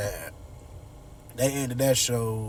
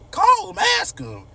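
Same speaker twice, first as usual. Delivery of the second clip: much higher, loud furious shout; angry shout; 7 dB louder than usual. Retold or imitated. Martin telling his sisters to confront Dad, furious shout. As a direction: yell it at them in anger. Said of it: "he mad".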